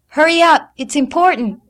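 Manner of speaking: high, loud urgent plea; urgent call. A narrator's reading. Daughter urging mother upstairs, loud. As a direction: hurry her with a loud plea.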